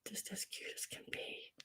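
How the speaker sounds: unvoiced, barely-there whisper. Real, not acted. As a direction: breathe it out softly. Gushing over figurines.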